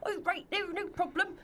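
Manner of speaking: put-on high-pitched caricature English accent; bad English accent. Imitating actor friend's attempt, high hammed-up English accent. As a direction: mimic a badly faked English accent, pitched right up. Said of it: "her bloody English accent".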